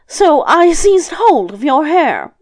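high, loud, slow character voice; grandmother making excuses. Acted. Grandmother explaining the pulled hair, high-voiced.

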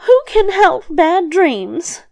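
high, slow, drawn-out question; soothing grandmother. A narrator's reading. Grandmother quieting the roaring devil, soothing. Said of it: "she quieted him once more and said".

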